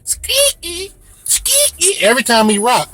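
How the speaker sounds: high-pitched squeaking car seat noise; squeaky seat. Retold or imitated. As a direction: imitate creaking seat hinges in a high squeak.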